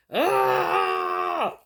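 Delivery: high, held "aah" scream; drawn-out cry. Acted. Acting out sudden total recall, drawn-out cry. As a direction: let out one long high cry. Cries out after "you like this.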